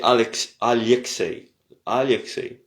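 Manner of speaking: "Alexei" repeated as a-lye-ksei; careful repeats of "Alexei", soft l; Russian soft l. Shown as a demonstration. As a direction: model "Alexei" with palatal ly. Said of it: "Très important de dire Alexei".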